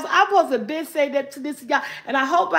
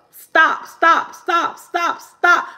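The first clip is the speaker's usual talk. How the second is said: rapid louder stops; quick repeated stop; 4 semitones higher, 4 dB louder, much slower than usual. Shown as a demonstration. Doing the trick with viewers, rapid stops. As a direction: fire off each stop fast. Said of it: "five times real fast".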